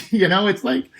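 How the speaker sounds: light laugh through the words, pitch up; chuckling in disbelief. Real, not acted. Vlogger laughs at the Trump supporter's answer, amused.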